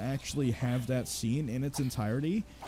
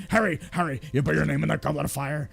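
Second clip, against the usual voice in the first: louder, fast mock-frantic demand; frantic Dumbledore; 4 dB louder, faster than usual. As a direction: rush at him in a hammed-up panic. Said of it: "Dumbledore runs after him and he's like".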